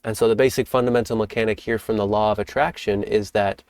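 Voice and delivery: usual speaking voice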